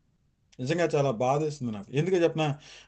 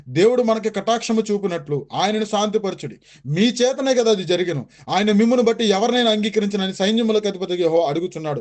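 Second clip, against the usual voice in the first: scripture reading; 3 semitones higher, 4 dB louder than usual. Acted. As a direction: proclaim each word of scripture deliberately. Reads Bible verse aloud.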